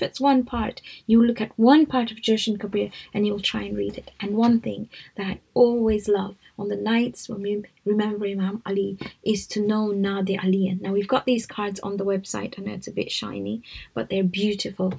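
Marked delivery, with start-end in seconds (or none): none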